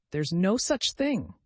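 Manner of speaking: slow, high, indignant protest; mild angry objection, drawn out. A narrator's reading. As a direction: insist it's nonsense, angrily and slowly. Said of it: "Alice was beginning very angrily".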